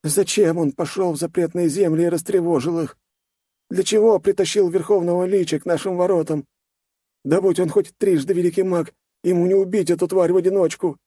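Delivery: put-on high, frail, fretful old voice; quavering elder worrying aloud; frail great grandma. A narrator's reading. Villager frets about the Lich.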